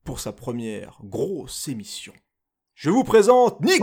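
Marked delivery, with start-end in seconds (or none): 2.8-3.8 loud, high host intro; excited announcement. Real, not acted. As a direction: call out the guest's name. Host announces new guest.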